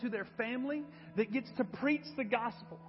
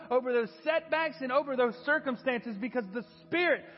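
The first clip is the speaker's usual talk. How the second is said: loud, driving preacher's call over music; rising sermon climax, loud and urgent; full-force preaching; 3 semitones higher, 6 dB louder than usual. Acted. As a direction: preach it to the back row.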